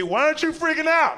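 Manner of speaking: high-pitched, hammed-up disbelief; panicked passenger. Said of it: "They gonna look at me".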